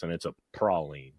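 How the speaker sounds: ordinary delivery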